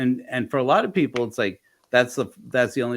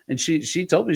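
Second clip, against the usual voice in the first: normal talking voice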